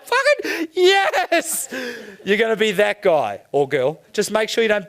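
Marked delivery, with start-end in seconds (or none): 0.1-1.7 high, drawn-out triumphant yell; long, high-pitched celebratory scream; ecstatic jackpot winner. Acted. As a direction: yell it like you just won a million. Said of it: "You just won a million bucks".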